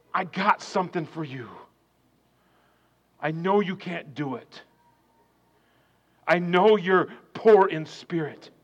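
low, hushed voice of God; God speaking softly. Acted. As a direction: soften right down and lean in close.

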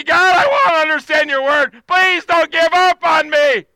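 loud, high, desperate plea; shouting a plea to be taught; crying out to God. Acted. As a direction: beg God at full voice. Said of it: "cried out with that".

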